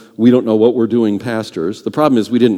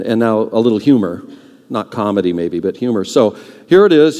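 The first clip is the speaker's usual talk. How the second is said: ordinary talk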